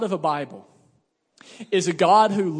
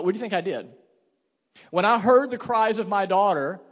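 ordinary delivery

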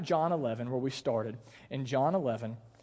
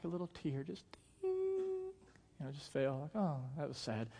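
soft, understated little sigh of sympathy; barely voiced, imitating a dainty tear; mock mild sadness; 9 dB quieter than usual. Mimics a small, polite sadness.

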